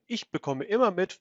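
ordinary talk, nothing marked

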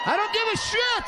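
high, loud yelled outburst; exploding shout. Retold or imitated. Husband blowing up at wife's shopping quiz. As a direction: blow up at her question.

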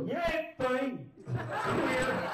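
high, sudden blurted jeer; drunk heckler. Retold or imitated. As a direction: imitate a drunk waking up to heckle. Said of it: "then he would wake up and go".

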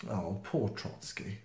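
usual speaking voice

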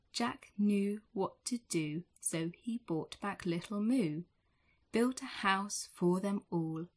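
usual speaking voice

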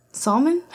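salmon as a questioning SAHL-mun; doubtful salmon. Shown as a demonstration. Unsure how to say it in English, salmon as SAHL-mun?. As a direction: lift salmon into a puzzled question, AH vowel. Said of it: "No, I don't even know".

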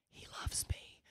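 soft fond whisper; whispered aside. Real, not acted. Aside to the audience about Frank, whispered. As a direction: let it slip out as a whispered aside.

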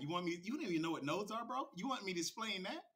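ordinary delivery

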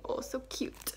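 whispered coo. Real, not acted. Cooing over puppy's name tag.

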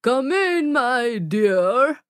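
a wolf pretending to be a kind old lady; wolf faking Granny. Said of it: "called the big bad wolf from the bed".

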